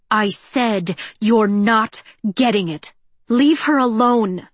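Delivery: slow, firm and loud; firm warning. A narrator's reading. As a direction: say it slowly through a hard warning. Cinder confronts the android.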